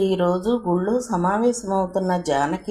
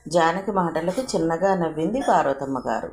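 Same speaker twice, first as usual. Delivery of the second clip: usual speaking voice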